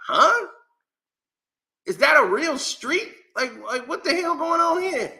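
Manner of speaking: high-pitched, incredulous questioning; baffled disbelief. Retold or imitated. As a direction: act totally thrown by what you're reading. Acts out baffled reaction to road names.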